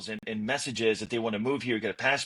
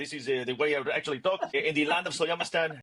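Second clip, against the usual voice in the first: hammed-up made-up Slow Jamistani accent, higher pitched; mock Slow Jamistani accent; 3 semitones higher than usual. Podcast guest jokes this is his real Slow Jamistani accent. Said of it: "this is actually my real accent right here".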